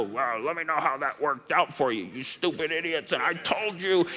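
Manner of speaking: mock a sulking deity who says I told you so; sulking sarcastic god. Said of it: "sit on his throne and cross his arms and go".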